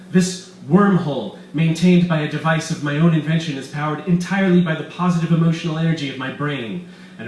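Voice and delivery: loud, gloating mad scientist voice; villain Weiss. Acted. Doctor Weiss gloating to his captives, loud and smug. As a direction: boast like a scheming villain.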